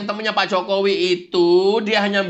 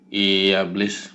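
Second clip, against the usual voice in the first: much lower, slow, glum complaining; sulky low Kurnia; 10 semitones lower than usual. Acted. Kurnia grumbles to the devil about being abandoned. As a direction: grumble like a sulking lackey. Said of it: "kenapa lu cemberut aja".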